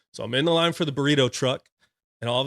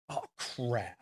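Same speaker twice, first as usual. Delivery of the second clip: very soft sinking realization; quiet, re-enacted dread; hushed dismay; 11 dB quieter than usual. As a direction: say it under your breath as it dawns. Realizes senior enlisted surround him.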